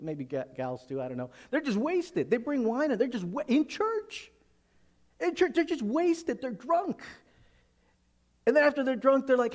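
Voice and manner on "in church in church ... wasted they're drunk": high-pitched, climbing disbelief; can you believe it, in church; incredulous outrage. Real, not acted. Preacher telling the congregation about drunks at the supper, appalled.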